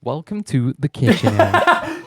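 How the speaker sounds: loud, high, hyped video intro; Minecraft YouTuber voice. Acted. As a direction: shout it like a kids' gaming channel intro. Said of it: "I got my Minecraft YouTuber voice going".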